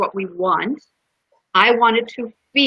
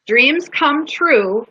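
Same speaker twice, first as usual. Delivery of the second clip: slow dramatic reading; 5 semitones higher, much slower than usual. Retold or imitated. Speaker reads the mural words to listeners, slow and deliberate. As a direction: read slowly and deliberately, pitch lifted.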